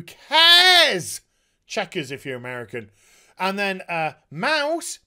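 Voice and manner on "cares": loud, high, scornful "who cares"; a sudden outraged yelp; exasperated shout. Real, not acted. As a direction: blurt it out in disgust. Ranting about a boring scene.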